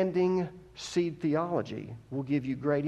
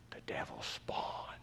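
breathy under-the-breath aside; hushed devil spawn aside; whispered aside; 13 dB quieter, slower than usual. Acted. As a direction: drop to a whisper for the jab. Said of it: "whispered aside".

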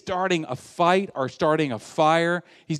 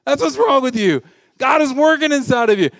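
emphatic answer; 8 semitones higher, 6 dB louder than usual. Acted. Acts out answer to the complainer.

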